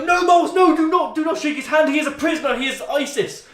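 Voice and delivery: urgent warning. Retold or imitated. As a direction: stop him, imitating the guards' alarm. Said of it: "and they go".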